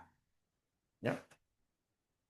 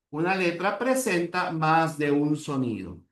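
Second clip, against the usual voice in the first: normal talking voice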